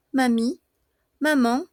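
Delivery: ordinary talk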